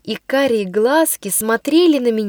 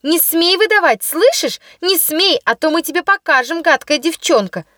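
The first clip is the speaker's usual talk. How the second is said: threatening shout; 5 semitones higher than usual. Acted. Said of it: "кричали они".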